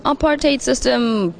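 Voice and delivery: ordinary talk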